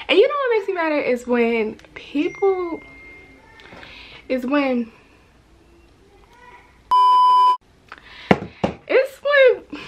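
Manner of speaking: building up heat as she vents; ranting. Real, not acted. Vlogger venting to viewers, rising annoyed rant. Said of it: "I be having a rant".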